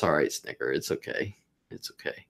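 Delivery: soothing pet. Real, not acted. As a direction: comfort the little one gently.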